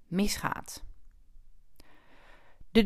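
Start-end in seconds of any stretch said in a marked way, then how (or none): 0.1-0.8 whisper. Acted. Dramatic drop to whisper.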